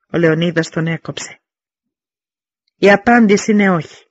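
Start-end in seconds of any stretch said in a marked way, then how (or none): 2.8-4.1 loud, high defiant shout from the wall; a king's flat loud no; shouted refusal. Acted. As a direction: bellow the refusal down at the envoy. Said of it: "φώναξε από το τείχος".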